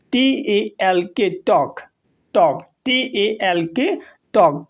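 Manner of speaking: slow, careful spelling for learners; letter by letter, slowly; slow spelling drill. Shown as a demonstration. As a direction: spell it out slowly, then say the word. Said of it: "spelling then word".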